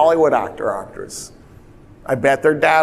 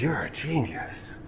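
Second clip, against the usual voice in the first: much softer, slow and impressed; hushed awe; 12 dB quieter than usual. Retold or imitated. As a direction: breathe it out, quietly amazed. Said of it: "And Roosevelt goes".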